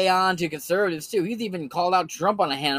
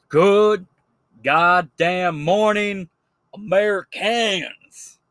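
Jericho Green; 3 semitones higher, much slower than usual. Retold or imitated. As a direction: stretch each word in a mock accent, like a slow greeting. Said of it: "I don't know why I gave him an accent".

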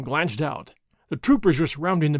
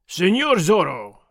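slow, louder bellow; gruff call through the door; 4 dB louder, slower than usual. A narrator's reading. Sergeant shouts to Zorro through the door, loud and slow. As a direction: call him out by name, drawn out. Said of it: "Sergeant Gonzalez cried".

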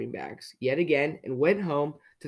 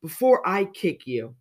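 raised, cross threat; snapped; 4 semitones higher, 4 dB louder than usual. A narrator's reading. Mister Grumpy threatens Mister Happy, snapped. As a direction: finish the threat with a higher snap. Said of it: "snapped Mister Grumpy".

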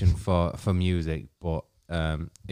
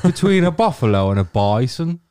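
mock Brummy joke voice; 10 semitones higher, 5 dB louder than usual. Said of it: "That's my sh-, shit brummy gig".

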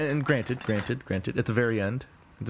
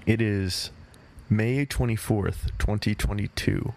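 ordinary talk, nothing marked

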